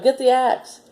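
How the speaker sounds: high, urgent shout; yelled. A narrator's reading. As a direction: yell the order in a hurry. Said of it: "he yelled".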